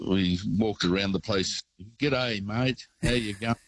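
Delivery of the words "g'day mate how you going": higher-pitched, exaggerated Aussie greeting; hammed-up broad Aussie accent; broad Australian accent. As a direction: lay the Australian twang on thick. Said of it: "broaden the accent".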